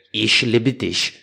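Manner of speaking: slow, drawn-out German accent; insult-like German. Shown as a demonstration. Declaring love in German, insult-like German accent. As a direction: make German love words sound like swearing. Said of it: "parece um xingamento".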